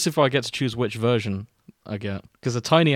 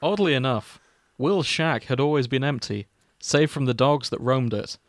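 mock-solemn fanfic reading, mostly at usual pitch; story narration; 3 semitones higher, 6 dB louder than usual. Podcast host reading a crack fic to cohosts, mock-solemn narration. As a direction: read the opening like a grave eulogy, tongue in cheek.

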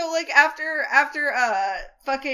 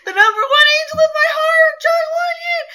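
much higher, louder, squealing delight; gushing fan; 10 semitones higher, 6 dB louder than usual. Real, not acted. Fan cheering her favourite's return, squealing delight. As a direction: gush over your favourite like a fan. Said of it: "my ultimate boy".

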